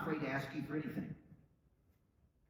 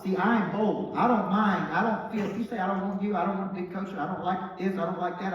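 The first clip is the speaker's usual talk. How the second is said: louder and higher, defiant; bold declaration; 4 semitones higher, 7 dB louder than usual. Acted. Speaker declares his boldness to the crowd, loud. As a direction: fire it out proudly.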